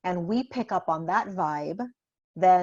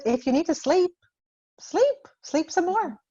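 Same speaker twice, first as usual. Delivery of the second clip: gentle coaxing; 10 semitones higher, 4 dB louder than usual. Retold or imitated. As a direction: coax the sleepy kid back to bed. Mom soothing sleepy son.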